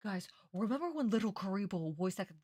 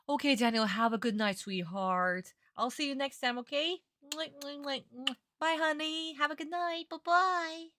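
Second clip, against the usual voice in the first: sweet goodbye; 8 semitones higher, 7 dB louder than usual. Real, not acted.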